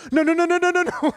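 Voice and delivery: panicked no no no, very high and loud; frantic high no's. Retold or imitated. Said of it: "And she went".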